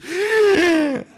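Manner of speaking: long, high, loud mock gasp of alarm; frightened gasp. Classmates gasping as he stands to hand in, startled cry. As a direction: imitate the whole class gasping in shock. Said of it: "es como espantados".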